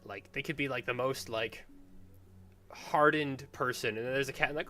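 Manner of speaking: ordinary delivery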